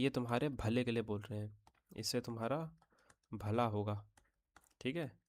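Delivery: soft, low imitation of a lecturing parent. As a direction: quietly mimic a parent's well-meaning lecture. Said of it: "फिर क्या बोलते हैं lecture देने के बाद".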